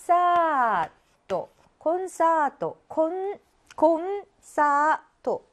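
slow teacher's model of 콘사토 in even beats. Shown as a demonstration. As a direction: stretch 콘사토 out, giving n its own beat. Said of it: "이렇게 읽어줘야 된다".

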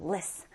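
"liss" as a short, unstressed "liss"; correct word ending. Shown as a demonstration. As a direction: sound out "liss" on its own. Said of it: "liss로 끝나요".

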